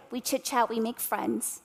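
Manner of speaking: ordinary talk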